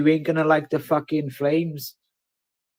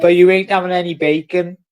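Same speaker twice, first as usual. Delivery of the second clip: laughing taunt; 4 semitones higher, 8 dB louder than usual. Real, not acted.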